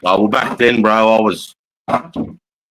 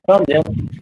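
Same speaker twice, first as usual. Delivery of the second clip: higher, gentle pet-talk; calming the storm-frightened dog, higher; soothing a pet; 5 semitones higher than usual. Real, not acted.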